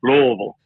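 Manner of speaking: Louisville mumbled as "LOO-uh-vull"; crawfish-in-mouth pronunciation. Shown as a demonstration. Coach demonstrating local way, Louisville slurred as "LOO-uh-vull". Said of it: "like you got some crawfish in your mouth".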